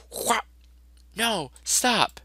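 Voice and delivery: high squeaky protest. Acted. Comic character begs in a high squeaky voice.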